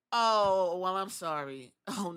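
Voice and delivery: ordinary delivery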